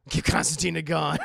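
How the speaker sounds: mock-dramatic call for a gun; mock demand. Streamer pleading for a character to get a gun, mock demand.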